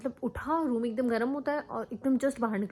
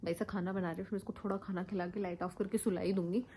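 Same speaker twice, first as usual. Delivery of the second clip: usual speaking voice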